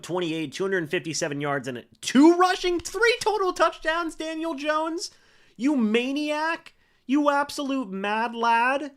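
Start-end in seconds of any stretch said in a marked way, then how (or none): 2.0-8.9 high, thrilled hype for the quarterback; excited cheering. Real, not acted. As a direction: gush over him like a proud fan. Cheering a quarterback's big stats.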